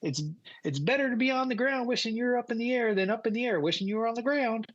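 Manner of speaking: high-pitched pilot reciting a saying; pilot impression. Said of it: "that's how he talked".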